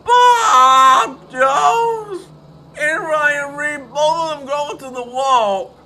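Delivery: high, shouted commentary with drawn-out names; alarmed crash call. Real, not acted. Commentator reacting to two cars hitting the wall, shouted excitedly. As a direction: cry out the crash as it happens.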